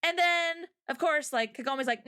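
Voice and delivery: usual speaking voice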